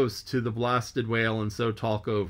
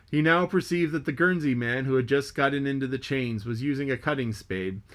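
usual speaking voice

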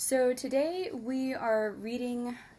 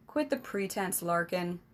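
snapped; 3 semitones lower than usual. Acted. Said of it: "the king snapped".

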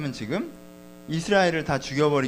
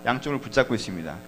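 normal talking voice

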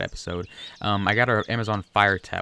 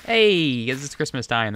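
excited exclamation; 7 semitones higher, 8 dB louder, slower than usual. Real, not acted. Spots festive colors in game.

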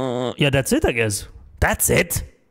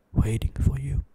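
eerie whisper; 3 dB quieter than usual. Pretend. Reading a creepy donation message.

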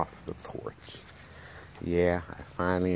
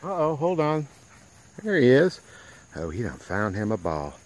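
ordinary talk

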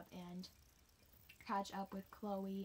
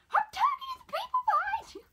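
high, loud yell past the runner; coach yelling; 15 dB louder than usual. Retold or imitated. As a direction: shout it over her shoulder at the stragglers.

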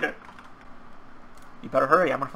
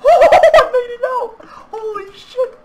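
excited shout; 9 dB louder than usual. Real, not acted. Escaping in game, elated.

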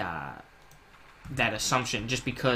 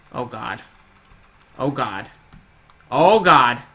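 panicked; 8 dB louder than usual. Real, not acted. Panicking while fleeing boss.

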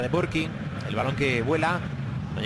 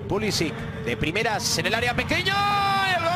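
excited commentary; 10 semitones higher than usual. Real, not acted. Goal chance commentary build-up.

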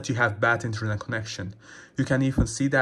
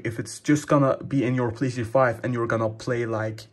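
ordinary delivery

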